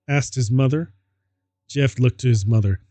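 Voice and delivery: ordinary talk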